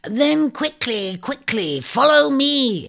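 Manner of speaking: high, drawn-out bird urging; urgent shrike hiss. Acted. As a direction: urge him on in a high, stretched bird voice. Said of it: "it hissed urgently".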